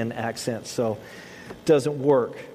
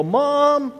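put-on whiny kid calling; 9 semitones higher than usual. Child calling mom to do it for them, high whiny call. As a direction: whine for help instead of doing it. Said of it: "It is so much easier to go".

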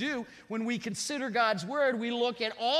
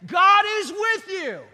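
much higher, louder, shouted; preaching climax; 8 semitones higher, 8 dB louder, slower than usual. Real, not acted. Pastor rouses the congregation with full-force shouting. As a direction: roar it out to wake them.

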